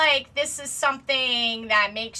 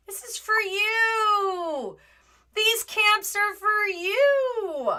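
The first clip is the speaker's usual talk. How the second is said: slow, higher mock-sobbing sarcasm; mock fake-crying; 7 semitones higher, much slower than usual. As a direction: fake tears, mocking the officials. Mocking government reassurance.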